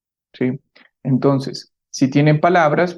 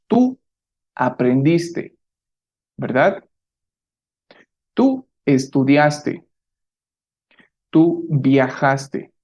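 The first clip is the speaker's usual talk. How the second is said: slow clear model; slower than usual. Shown as a demonstration. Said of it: "escuchar la pronunciación".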